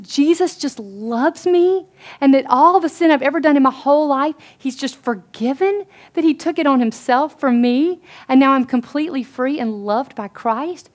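high, loud incredulous wonder; amazed questioning, acted with rising force; retold astonishment. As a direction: imitate a friend stunned by good news. Retold friend's astonished realisation.

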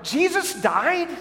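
put-on high, slow, stunned little girl's cry; little girl blurting out in shock; shocked child. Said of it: "she shouted out".

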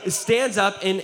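ordinary talk, nothing marked

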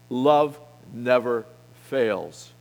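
slow solemn stress on never; bold declaration. Acted. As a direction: hammer each word, slowly. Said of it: "I'm talking boldly tonight".

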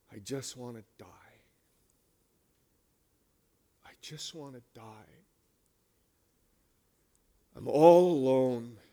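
despairing whisper. Retold or imitated. Elijah, alone and exhausted, begging to die in a hushed whisper. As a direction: let it fall away to almost nothing.

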